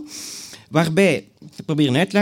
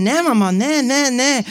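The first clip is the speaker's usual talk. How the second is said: protesting daughter; 9 semitones higher, 4 dB louder than usual. Retold or imitated. Wife protests to mother.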